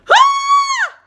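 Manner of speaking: very high loud mock scream back; startled scream. Vlogger reenacting her own scream at the fox. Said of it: "And I said".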